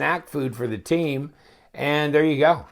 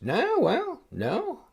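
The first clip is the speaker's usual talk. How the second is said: surprised disbelief; 12 semitones higher, 4 dB quieter, much slower than usual. Real, not acted. Reacts to measuring blade stock.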